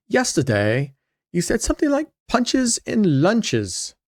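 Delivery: amateur attempt at South African, high and lilting; South African accent. Quoting a community member's question, in a hammed-up South African accent. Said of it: "That's my best South African accent".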